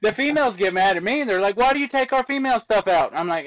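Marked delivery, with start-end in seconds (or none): 1.6-3.1 higher, whiny mock complaint; mock upset commenter. Pathologist mocking TikTok commenters, higher indignant voice. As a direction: play the offended viewer, pitched up.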